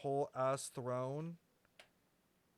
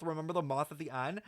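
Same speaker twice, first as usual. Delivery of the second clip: normal talking voice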